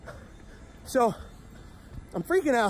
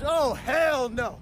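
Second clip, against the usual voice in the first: flat refusal; 4 semitones higher, much slower than usual. Retold or imitated. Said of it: "She goes".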